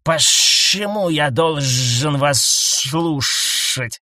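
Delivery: slow suspicious snake husband; distrustful, drawn out. A narrator's reading. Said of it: "подозрительно бросил крулянец". (подозрительно, медленно)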